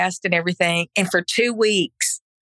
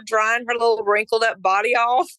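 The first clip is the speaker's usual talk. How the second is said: ordinary talk, nothing marked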